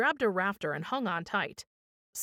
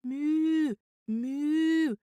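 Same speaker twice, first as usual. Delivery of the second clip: two long, high cow-like moans; drawn-out, high ghostly mooing; moaning moo; 7 semitones higher than usual. A narrator's reading. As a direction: moo slowly, trying to moan like a ghost. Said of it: "You sound like a cow".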